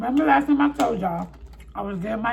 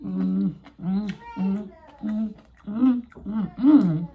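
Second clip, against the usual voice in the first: savoring mm; 3 semitones lower, 3 dB quieter, slower than usual. Real, not acted. Tasting the food.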